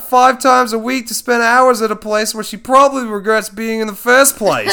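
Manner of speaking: loud, high mock Aussie wildlife narrator; Australian accent. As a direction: ham up an Australian nature-show voice. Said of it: "Laughs in Australian".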